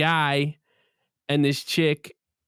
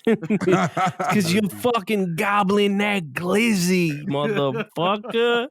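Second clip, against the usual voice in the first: laughing slow retort; much slower than usual. Retold or imitated. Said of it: "He's like".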